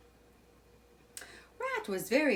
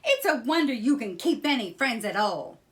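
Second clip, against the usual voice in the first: angry; 5 semitones higher, 8 dB louder than usual. A narrator's reading. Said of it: "Rat said angrily".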